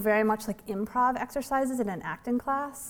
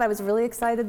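ordinary talk, nothing marked

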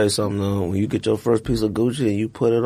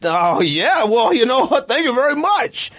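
surprised; 10 semitones higher, slower than usual. Retold or imitated. Young hire thanking the manager, surprised and rising. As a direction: caught off guard, stammering out thanks rising high. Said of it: "I was like".

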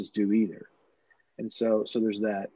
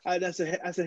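normal talking voice

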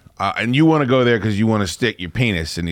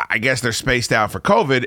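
usual speaking voice

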